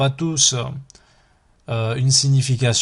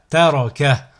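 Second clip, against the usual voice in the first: syllables joined as one word; 3 dB louder than usual. Shown as a demonstration. Teacher models a word for learners to repeat, joined in one go. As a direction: run the syllables together without pauses. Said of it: "le mot attaché".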